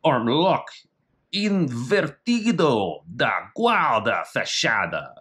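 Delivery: slow, careful Portuguese pronunciation of the technique name; Portuguese reading. Shown as a demonstration. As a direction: read the Portuguese title slowly and with effort.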